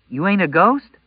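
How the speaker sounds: put-on high, loud, scared girl voice; a frightened girl asking; scared teen girl. A narrator's reading. Frightened girl questions stranger.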